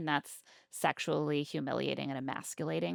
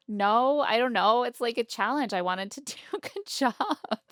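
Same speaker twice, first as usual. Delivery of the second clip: higher, louder flustered defending; flustered protest; 7 semitones higher, 4 dB louder than usual. Retold or imitated. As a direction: blurt it out, caught off guard. Acted flustered girlfriend quote.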